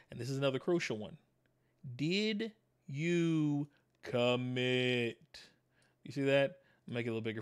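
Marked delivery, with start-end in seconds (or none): 2.0-5.4 Did you commit? stretched very slowly, each word held; drawn-out question. Acted. Coach pressing his students on their commitment, drawn out slowly. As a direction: pull every word out long and probing.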